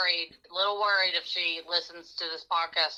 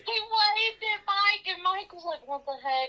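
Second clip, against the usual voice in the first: excited squeal; 12 semitones higher than usual. Real, not acted. Excitedly retelling Derek scene.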